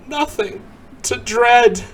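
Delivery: ordinary delivery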